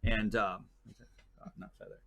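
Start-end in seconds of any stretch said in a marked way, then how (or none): 1.4-2.0 whispered aside. Shown as a demonstration. Slipping the host a cheeky joke, whispered aside. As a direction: repeat Dot, not Feather in a sly whisper, unvoiced. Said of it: "I saw that".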